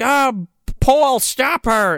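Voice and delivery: high, slow call to a guard; half-hearted high-pitched yell; mock yell. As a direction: call out as if raising the alarm, without full volume. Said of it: "yells at the security guard".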